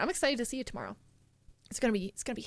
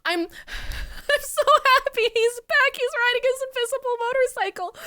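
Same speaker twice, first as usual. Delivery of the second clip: laugh-cry the words out of pure delight; real giddy squealing laughter; 11 semitones higher, 5 dB louder than usual. Real, not acted. Thrilled watching new video.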